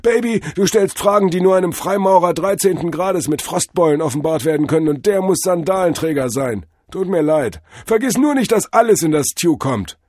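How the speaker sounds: high, loud hip guru; jive-talking drug-vision god. A narrator's reading. God dodges Cordell's spice question, high loud guru. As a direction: lecture the disciple as a trippy god. Figure of Tod Hermes.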